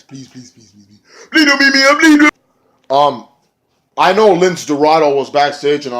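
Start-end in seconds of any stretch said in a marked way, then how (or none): 0.0-1.0 much softer begging fading out; fading pleading. Pretend. Begging trailing away. 1.3-2.3 much higher, louder squeaky plea; mock scared plea. Mock fearful begging.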